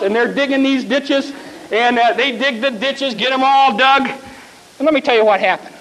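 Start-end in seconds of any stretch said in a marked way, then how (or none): none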